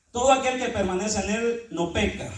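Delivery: loud preaching proclamation; full-force preaching. Acted. Preacher proclaiming scripture to the congregation, loud. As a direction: proclaim it to the church. Closing exhortation.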